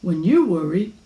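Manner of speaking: a hint of an emphatic dad; father leaning on you and I; concerned father. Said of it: "said her father".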